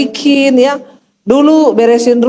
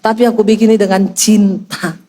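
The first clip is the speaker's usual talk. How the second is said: lightly voiced husband, lower pitch; loving husband; 7 semitones lower than usual. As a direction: imitate a husband sweet-talking in a low voice. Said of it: "Toto dia bilang".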